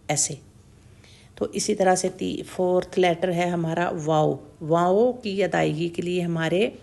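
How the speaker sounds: normal talking voice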